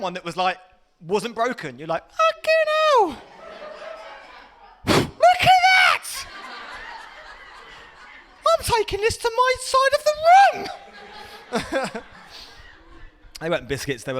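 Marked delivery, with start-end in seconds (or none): 2.1-12.0 very high, loud excited shriek; ecstatic discovery. Acted. Lad finding a whole biscuit, hammed-up ecstatic shriek. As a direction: shriek it with overblown childlike amazement.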